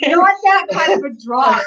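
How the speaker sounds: can't stop laughing; words breaking into giggles. Real, not acted. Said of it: "now I can't stop laughing".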